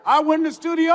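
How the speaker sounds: usual speaking voice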